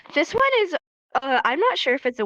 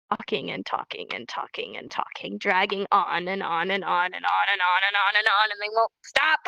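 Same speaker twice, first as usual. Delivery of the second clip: droning on; 4 semitones lower than usual. Shown as a demonstration. Teacher showing someone dragging on and on, droning. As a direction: keep it rolling on without a break. Mimes endless droning talk.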